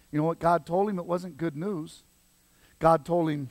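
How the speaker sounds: ordinary talk, nothing marked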